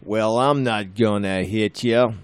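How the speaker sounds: casual cowboy tough-guy line, barely put on; John Wayne impression. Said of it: "give me an impression of John Wayne".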